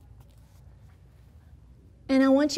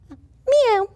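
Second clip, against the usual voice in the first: loud, much higher falling cat "meow"; kitty meow; 12 semitones higher, 8 dB louder than usual. Shown as a demonstration. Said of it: "a kitty cat says".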